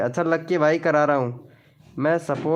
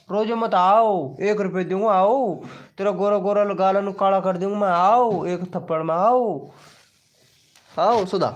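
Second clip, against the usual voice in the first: playful mock scolding; 4 semitones higher than usual. Real, not acted. Father teases his crying child with playful mock threats.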